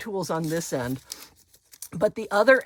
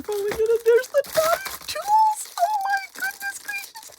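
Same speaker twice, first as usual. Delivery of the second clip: ecstatic squeal; 13 semitones higher than usual. Real, not acted. Unboxing long-wanted dotting tools.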